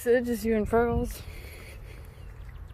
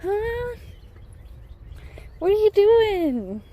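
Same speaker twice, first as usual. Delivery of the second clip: high, slow call; sing-song call; 11 semitones higher, 6 dB louder, slower than usual. Real, not acted. Asking her horse Diva in a high sing-song. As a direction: stretch it up high and sweet.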